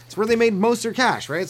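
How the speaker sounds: ordinary delivery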